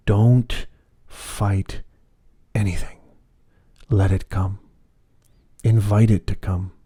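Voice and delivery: slow meditation guide with long pauses; measured, unhurried meditation voice; guided meditation. Acted. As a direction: lead them gently inward, phrase by phrase.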